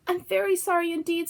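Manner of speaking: Dorothy scared by the melting witch; frightened. A narrator's reading. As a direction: blurt it out scared. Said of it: "truly frightened".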